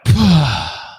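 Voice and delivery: loud, forceful breath out; demonstrated big sighing release; big letting-go exhale. Retold or imitated. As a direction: blow all the tension out at once. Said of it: "Let it out".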